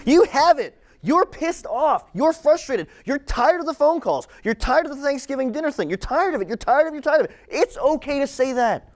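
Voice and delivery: high, insistent preaching in punchy repeated phrases; fervent high-pitched exhortation; impassioned preaching. Real, not acted.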